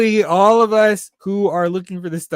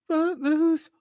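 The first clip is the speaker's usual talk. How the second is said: squeaky repeat; 13 semitones higher than usual. Real, not acted. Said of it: "repeating the name".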